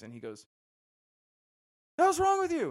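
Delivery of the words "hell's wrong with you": sudden loud, high-pitched snap; snapping sergeant. Retold or imitated. Drill sergeant to a frozen recruit, snapping loudly. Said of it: "snapped for a second".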